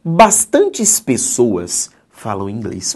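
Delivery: slow, careful reading of the new sentence; slow demo. Shown as a demonstration. Teacher showing the plural bastantes to students, slow. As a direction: read it out slowly to show the form. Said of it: "Assim, ó".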